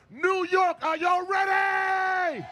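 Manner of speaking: big crowd call, stretched out; fired-up shout. Real, not acted. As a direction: rouse the whole crowd. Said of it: "I need to get fired up".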